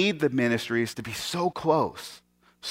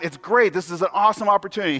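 higher, hurried pastor gushing; mock an over-eager pastor running on; blathering; 5 semitones higher than usual. Idiot pastor pitches his awesome opportunity, blathering. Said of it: "he blathers a lot".

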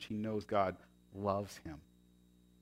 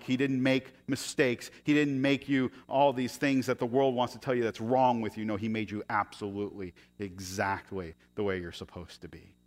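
normal talking voice

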